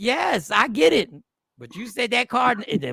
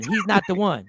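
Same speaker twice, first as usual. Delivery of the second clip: ordinary talk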